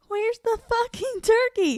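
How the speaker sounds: slow, high, drawn-out yell; mock yell. Retelling the actress yelling for the turkey, slow high yell. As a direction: stretch it out in a high frantic yell. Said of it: "He goes".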